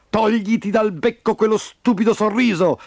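high, harsh order; extremely rude. A narrator's reading. Rooster chief to poor old aunt, rudely scolding. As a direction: snap rudely at her. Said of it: "fu estremamente scortese".

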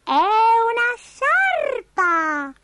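very high, long drawn-out reveal; tiny creature presenting a gift; Abigail the little spider. A narrator's reading. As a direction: stretch it out in a squeaky falsetto.

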